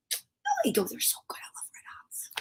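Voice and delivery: soft breathy whisper aside; secretly disagree with her. Acted.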